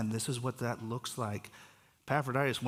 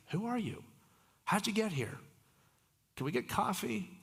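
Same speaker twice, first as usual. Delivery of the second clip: bright friendly greeting; 8 semitones higher than usual. Retold or imitated. Greeting strangers at a stoplight.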